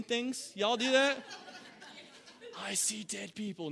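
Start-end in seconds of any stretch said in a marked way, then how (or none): 2.6-3.7 hushed, mock-spooked delivery; a touch of eerie hush; creepy film quote. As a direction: softly confess it like a scared film character. Mock film line, seeing things.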